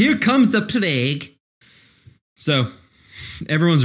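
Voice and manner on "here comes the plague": put-on higher, slow Boston accent. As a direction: stretch out plague Boston-style. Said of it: "That's the word, Max. Well done".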